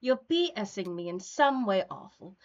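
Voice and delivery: lightly voiced character, measured delivery; in-character script reading. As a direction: read the character's complaint with deliberate phrasing. Reads a character's lines in the script.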